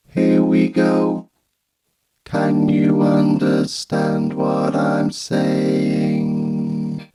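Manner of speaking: very slow synthesized robot speech; vocoder voice. Shown as a demonstration. Reviewer checking the vocoder sounds clear, slow robotic voice. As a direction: stretch every word through a vocoder. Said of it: "to vocoding".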